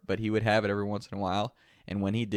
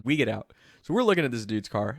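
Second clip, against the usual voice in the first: ordinary talk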